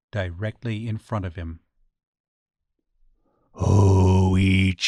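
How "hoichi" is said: loud, long-drawn call on one low note; deep calling voice. A narrator's reading. Deep voice calling the blind man from the veranda, loud drawn-out summons. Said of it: "the deep voice called".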